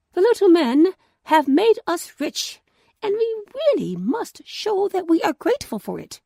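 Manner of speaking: a lightly voiced wife full of plans; grateful wife.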